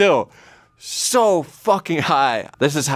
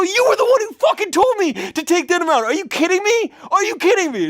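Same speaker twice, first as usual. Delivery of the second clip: much higher, strained panicked squeal; panicked shriek. Retold or imitated. Answering the roommate about the cake, high panicked shriek. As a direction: squeak it out in full mock panic.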